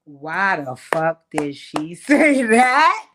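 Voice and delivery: slow, drawn-out incredulous question swelling to a yell; hammed-up outrage; loud disbelief. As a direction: stretch every word, building to a loud finish. Outraged disbelief at what was said.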